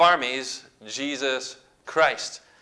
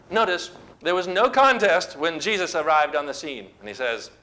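ordinary talk

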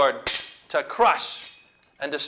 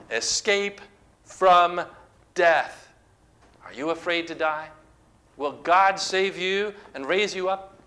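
normal talking voice

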